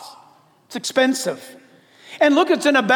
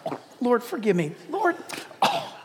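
mock guilty murmur; 7 dB quieter than usual. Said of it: "It's like".

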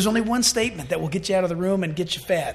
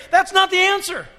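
buzzer rejection; 8 semitones higher, 9 dB louder than usual. Acted. Gatekeeper rejecting wrong answer.

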